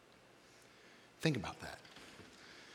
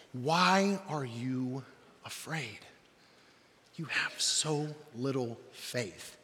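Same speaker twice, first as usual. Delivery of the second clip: slow, weighted reproach sinking softer; disappointed, annoyed; 2 semitones lower, 5 dB quieter, much slower than usual. Acted. Jesus to terrified disciples, disappointed and annoyed. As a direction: draw the words out in weary disappointment. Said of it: "You can almost hear the disappointment".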